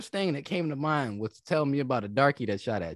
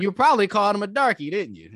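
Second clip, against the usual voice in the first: fast, louder accusing jab; mock interrogation; 6 semitones higher, 7 dB louder than usual. Host teasingly accuses the veteran caller, fast and loud. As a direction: corner him with a smug question.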